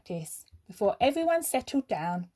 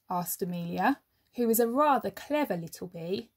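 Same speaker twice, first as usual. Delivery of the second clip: plain reading voice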